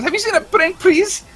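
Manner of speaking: very high, loud squeaky character voice; imitating the prankster's high, loud shriek. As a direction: ham up a shrill cartoon prankster yelling. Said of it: "He goes".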